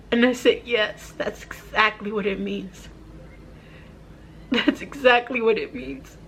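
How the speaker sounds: choked up, halting between phrases; emotional, tearful. Real, not acted. Mother retelling her daughter's question about her dad, tearful. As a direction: retell it through real tears. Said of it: "I don't wanna get emotional".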